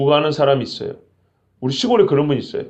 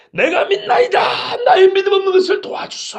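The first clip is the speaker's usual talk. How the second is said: a father crying out in desperation, much higher; desperate shout; 14 semitones higher than usual. Retold or imitated. The boy's father begs Jesus for help, shouting high. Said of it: "소리를 질렀다 해서".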